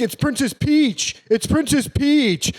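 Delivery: high, slow insistent nerd correction; pedantic correcting. Retold or imitated. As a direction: correct him like a fussy fan. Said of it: "you were like".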